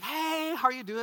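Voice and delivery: high, drawn-up hello; retold cheery greeting. Preacher greets an old acquaintance at the driving range, high and cheery. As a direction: imitate a surprised, friendly greeting.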